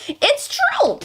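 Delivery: high-pitched insisting; high squeaky voice. Pretend.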